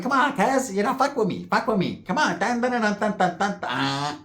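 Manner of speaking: high-pitched frantic tweaker pestering; super tweaker. Acted. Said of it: "We'll do super tweaker agent".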